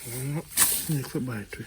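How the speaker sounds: hushed and careful, barely voiced. Real, not acted. Creeping toward a snake in the bush, hushed. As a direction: keep it down so the snake won't hear.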